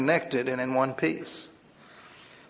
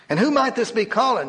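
a higher, pointed demand; 8 semitones higher, 3 dB louder than usual. Acted. Nurse demanding the caller's name over the phone. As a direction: press him like it might be a prank. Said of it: "she asked in a demanding voice".

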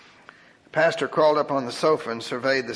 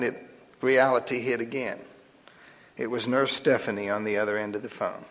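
ordinary delivery, nothing marked

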